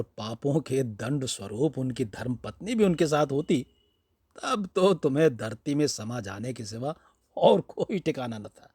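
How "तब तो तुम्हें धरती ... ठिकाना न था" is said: ordinary delivery, nothing marked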